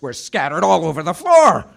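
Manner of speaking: climbing to a loud, aghast finish; storyteller's rising dismay; aghast narration. A narrator's reading. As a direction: build in outrage to the last word. Narrator relives the shock.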